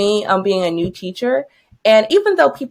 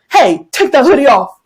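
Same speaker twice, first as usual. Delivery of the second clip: louder mock yell of an order; retold yell; 3 semitones higher, 7 dB louder than usual. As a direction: imitate a man calling out sharply from behind. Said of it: "a man yell behind me".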